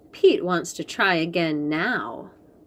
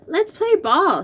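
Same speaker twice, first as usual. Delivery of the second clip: eager invite; 10 semitones higher, 5 dB louder than usual. Acted. Pete's excited invitation.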